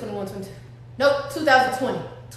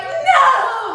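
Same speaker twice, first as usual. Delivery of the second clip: shocked no; 9 semitones higher, 4 dB louder than usual. Retold or imitated. Denying she's pregnant.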